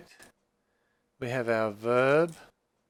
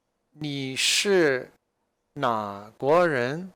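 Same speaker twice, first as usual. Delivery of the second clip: "你是哪国人" stretched out syllable by syllable; very slow model question; much slower than usual. Shown as a demonstration. Teacher reading Zhang Laoshi's question very slowly to students. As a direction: draw every syllable out for beginners. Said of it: "Zhang Laoshi then asks".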